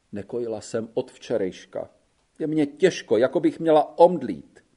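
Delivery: plain reading voice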